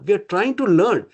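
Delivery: "trying" lifted high, then a fall; stress on trying. Real, not acted. Teacher telling devotees what counts, emphatic stress on "trying". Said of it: "emphasizing the word trying".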